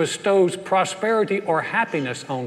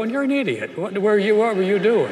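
lightly voiced, high, scolding mama; a touch of the exasperated mother; mock Southern mother; 6 semitones higher than usual. Mother's real meaning behind the blessing, mildly voiced higher. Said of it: "A very kind Southern word to go".

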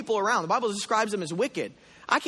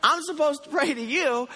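higher and louder, indignant complaint to God; hammed-up frustrated outburst; retold angry protest; 8 semitones higher, 4 dB louder than usual. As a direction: argue with God like you've been cheated. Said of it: "I was getting mad at God".

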